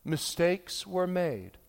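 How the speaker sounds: slow, dry politician non-apology; evasive politician. Retold or imitated. Preacher mocking politicians with a slow, evasive politician voice. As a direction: drag it out like a politician ducking blame. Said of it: "the one when politicians say".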